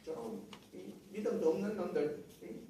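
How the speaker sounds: quiet, imitated grumbling anger; hushed mock scolding; muttered anger. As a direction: act out fuming under your breath. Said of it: "그러면서 막 화를 내면서".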